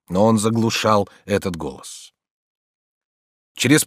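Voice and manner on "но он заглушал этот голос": ordinary delivery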